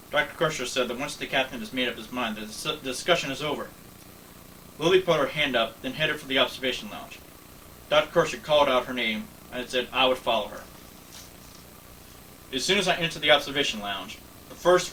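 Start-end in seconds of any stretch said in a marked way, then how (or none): none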